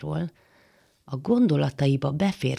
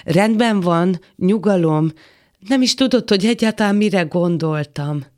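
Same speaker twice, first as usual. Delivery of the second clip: raised voice, louder and higher; agitated protest; 3 semitones higher, 5 dB louder than usual. Acted. Man defends himself to the woman, agitated. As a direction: protest loudly while backing off.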